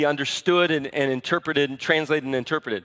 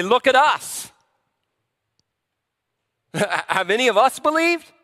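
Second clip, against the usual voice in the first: high, self-important Pharisee voice; proud, pretentious; 6 semitones higher, 3 dB louder than usual. Acted. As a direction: raise it in haughty disbelief. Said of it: "They're so proud and pretentious".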